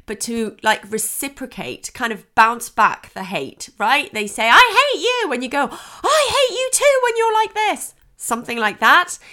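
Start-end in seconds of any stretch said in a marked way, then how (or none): 4.5-5.3 high, loud imitated tantrum cry; mock child outburst. Acting an angry child's outburst. 5.7-7.9 high-pitched acted outburst; mock parental retort. Acting a parent hurling hate back.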